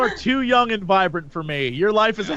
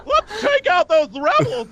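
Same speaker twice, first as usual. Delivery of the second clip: high villain voice; 7 semitones higher, slower than usual. Pretend. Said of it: "I'm just gonna be like".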